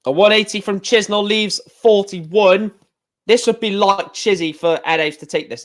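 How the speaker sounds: lively play-by-play call of each throw; darts commentary. Acted. Commentator calling the match to viewers, lively play-by-play.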